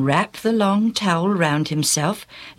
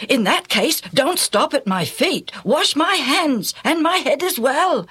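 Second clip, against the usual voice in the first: higher, rising eager plea; eager Peter; 9 semitones higher than usual. A narrator's reading. Peter begging Jesus to wash all of him, eager and higher. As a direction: rush to ask for more washing. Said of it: "he said quickly".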